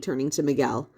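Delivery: usual speaking voice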